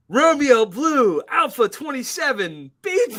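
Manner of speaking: high, loud, slow mock radio calls; calling out codes. AI calling nonsense codes at confused students. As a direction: bark them like a quarterback audible.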